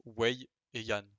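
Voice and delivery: "Wei et Yan" said slowly and clearly, syllable by syllable; slow demo of Wei and Yan; careful pronunciation. Shown as a demonstration. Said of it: "ça va, c'est simple".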